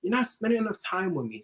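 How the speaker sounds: put-on complaining girlfriend. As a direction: imitate a girlfriend's mild whine. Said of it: "she'll still say".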